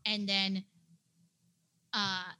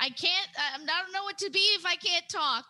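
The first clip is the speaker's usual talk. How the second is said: higher and faster, desperate plea; desperately; 10 semitones higher, faster than usual. Acted. Alien woman pleading with the party, desperate. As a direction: beg as if losing your voice. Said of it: "She says sort of desperately".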